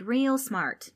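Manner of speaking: mildly exasperated mock praise; annoyed. A narrator's reading. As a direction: say it with irritated sarcasm.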